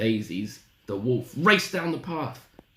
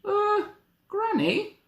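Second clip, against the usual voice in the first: put-on very high, uncertain little girl; hesitant Little Red; much slower than usual. Little Red puzzled by Granny's looks, high and hesitant. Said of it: "she said slowly".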